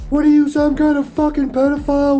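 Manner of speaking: high-pitched mocking rant of an angry woman; vitriolic woman. Woman accusing a drone pilot of spying, mock vitriolic rant. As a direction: imitate her tirade in a squawky high voice. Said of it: "she just vitriol out of her mouth automatically".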